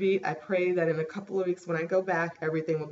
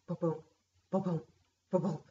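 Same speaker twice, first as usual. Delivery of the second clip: heartbeat imitation; 4 dB quieter than usual. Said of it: "And all of a sudden I heard".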